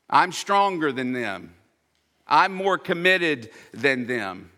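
a touch of Peter's proud protest; proud boast. Retold or imitated.

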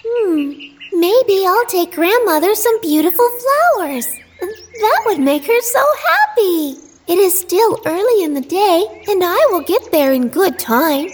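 put-on high, musing girl voice; little girl voice. A narrator's reading. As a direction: think aloud as a small girl. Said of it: "she thought".

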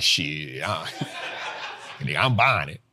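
low, mumbled reckless buyer with a laugh; careless buyer impression. Mocking impatient investors who buy anyway, in a low lazy voice. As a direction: drop low and shrug it off like a careless trader. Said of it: "Some people like".

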